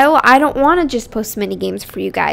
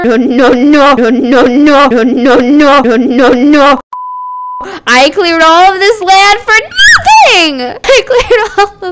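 louder hammed-up wail of no; mock despair shout; 7 dB louder, slower than usual. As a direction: yell over the lost land. Land was destroyed.

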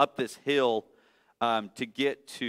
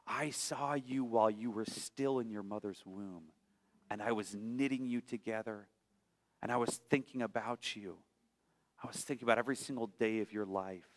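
much softer, intimate reassurance; tender God voice; 3 semitones lower, 12 dB quieter than usual. Acted. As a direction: speak as a loving God, close and soft. Voicing God's love.

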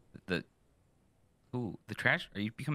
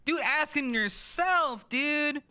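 very high, slow, louder whine; squeaky exasperation; 3 dB louder, much slower than usual. Acted. Arguing about the offering, whining up high.

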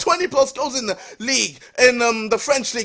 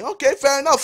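normal talking voice

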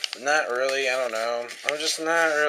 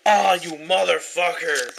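swearing angrily, a bit higher; real angry curse; 4 semitones higher, 4 dB louder than usual. Real, not acted. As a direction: curse the card out loud. Cursing the card tearing page.

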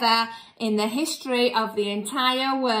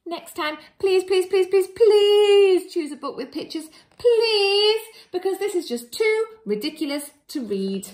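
high, loud hammed-up pleading; mock begging; 9 semitones higher, 8 dB louder than usual. Reader begging the children for picture books, loud mock plea. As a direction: plead loudly and dramatically.

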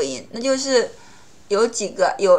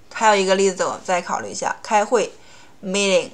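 normal talking voice

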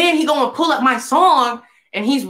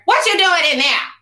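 higher-pitched imitation of a nosy mom; mom in background; 6 semitones higher than usual. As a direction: call out like a mother from another room. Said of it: "his mom in the background".

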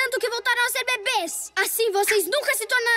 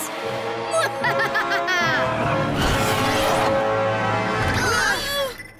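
evil laugh; 2 semitones lower than usual. Acted. Villain gloats over victory.

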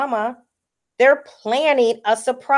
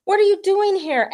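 high, surprised question; 10 semitones higher than usual. Acted. Lima spots Gilroy after feeling left out, surprised and high. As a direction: pop up in surprise at seeing a friend. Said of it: "Lima Lima is surprised to see him".

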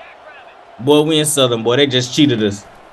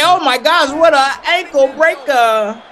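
high, stretched-out amazed cry; excited gamer yelling at a great move; 10 semitones higher than usual. Real, not acted. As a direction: marvel at the ankle breaker.

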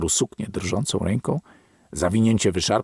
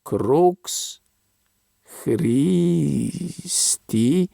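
syllable by syllable, with effort; laborious spelling out; 6 semitones higher, much slower than usual. A narrator's reading. Old man straining to read the Latin, spelling it out slowly. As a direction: sound out the Latin letters with difficulty. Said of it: "z trudem sylabizował".

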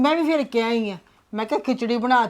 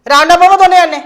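louder, a sharp command to sit; loud angry order; 5 semitones higher, 9 dB louder than usual. Acted. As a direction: shout them into silence. Mother orders the kids to sit. (ਉੱਚੀ ਗੁੱਸੇ ਵਾਲਾ ਹੁਕਮ)

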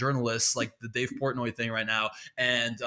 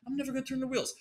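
much higher, defiant taunt; defiant high mock; 10 semitones higher, 6 dB quieter, much faster than usual. Shown as a demonstration. Comedian taunting the police, pitched high and mocking. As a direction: sing it up high to mock the cops. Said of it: "How about that?".

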